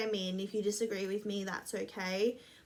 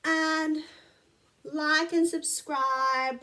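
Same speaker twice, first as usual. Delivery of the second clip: slow, drawn-out plea; 6 semitones higher, 6 dB louder, much slower than usual. Real, not acted. Repeated slow plug.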